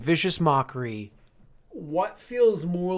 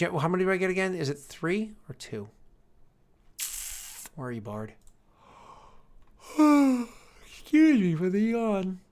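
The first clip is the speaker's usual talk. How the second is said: ordinary talk, nothing marked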